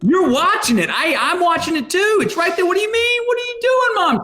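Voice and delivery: climbing, exasperated disbelief at mom; incredulous. Retold or imitated. Son on phone confronting his mom about the TV show, exasperated rising disbelief.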